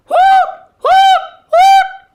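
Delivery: very loud, high whooping hoots; owl hooting. A narrator's reading. Owl waking everybody up, loud high hooting. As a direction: hoot high and full out, one at a time. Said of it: "then Owl hooted".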